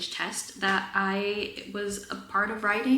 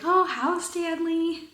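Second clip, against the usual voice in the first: cheery greeting; 10 semitones higher, 4 dB louder, slower than usual. Retold or imitated. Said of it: "they were like".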